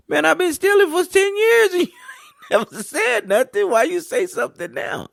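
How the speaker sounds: high, loud squeal of a caught thief, laughing; mock outraged thief. Coach mocking a kid caught stealing, high indignant squeal. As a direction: whine like someone caught stealing after ten years.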